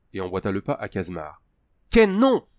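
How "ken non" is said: much higher, loud desperate yell. A narrator's reading. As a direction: scream to stop him. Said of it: "hurla Cal".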